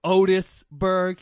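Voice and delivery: loud, slow Otisburg; savoring it. Shown as a demonstration. Repeating the line with relish.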